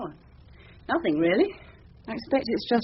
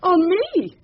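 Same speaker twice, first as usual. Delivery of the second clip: flustered by unexpected praise; surprised disbelief; 7 semitones higher than usual. Acted. Young barrister disbelieving she has been noticed, surprised.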